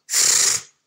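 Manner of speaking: plain reading voice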